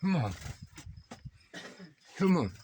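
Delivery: coaxing a wild bird. Real, not acted. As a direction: urge the bird out, pitch up.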